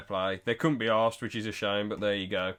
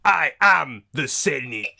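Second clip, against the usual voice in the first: slow, menacing Sith lord; cold rasping evil emperor; 4 dB louder, slower than usual. Retold or imitated. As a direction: drag it out like a villain. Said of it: "And he says".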